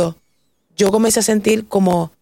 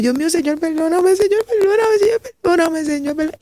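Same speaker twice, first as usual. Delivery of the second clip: very high, tearful-sounding begging; desperate plea; 9 semitones higher than usual. Retold or imitated. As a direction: cry out to God for forgiveness. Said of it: "empecé a pedirle perdón". (súplica desesperada, muy aguda)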